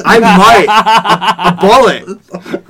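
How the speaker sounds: loud, slow, laughing it out. Real, not acted. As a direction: laugh while coining the word. Joking about a ball mullet.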